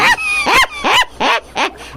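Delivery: very high creature laugh; gloating laugh. Acted. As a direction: cackle as the trap closes. Creature gloats over the rider.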